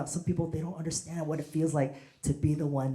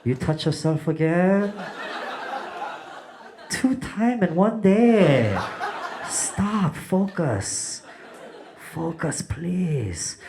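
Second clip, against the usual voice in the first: put-on slow, higher and louder scolding father with a Korean accent; strict dad voice; 4 semitones higher, 5 dB louder, much slower than usual. Immigrant dad scolding his son.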